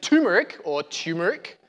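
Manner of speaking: turmeric as TOO-muh-rick, then CHEW-muh-rick; mispronounced turmeric. Retold or imitated. Quoting how many people say it, turmeric as "toomeric" or "choomeric". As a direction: drop the first R from turmeric both times. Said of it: "so many people pronounce it".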